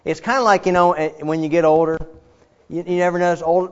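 ordinary talk

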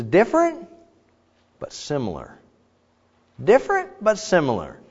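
high on "Different", dropping on "similar"; intrigued musing. Acted. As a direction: act out pleased, curious comparison. Adam compares the woman to himself.

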